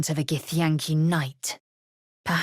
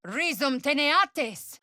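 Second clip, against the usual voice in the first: high ringing incantation; spellcasting; 9 semitones higher than usual. Acted. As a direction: cast it aloud.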